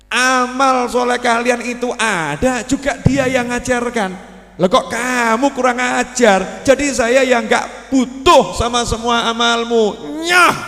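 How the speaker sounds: stern divine rebuke. Acted. God answering the ungrateful worshipper, slow stern rebuke. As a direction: lay down the verdict slowly.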